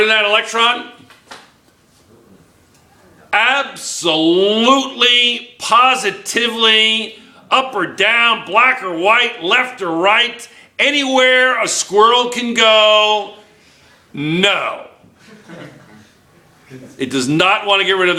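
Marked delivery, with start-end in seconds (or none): 3.3-14.9 drawn-out, higher-pitched, emphatic build to a final no; slow, hammy absolute denial. Real, not acted. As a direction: stretch each phrase out for comic emphasis. Comic, emphatic refusal to a question.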